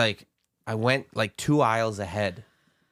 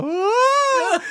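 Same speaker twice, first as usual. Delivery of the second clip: put-on high, loud wail of a lost little boy; little kid's crying wail; 6 dB louder than usual. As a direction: wail it out like a scared small child. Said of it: "And I go".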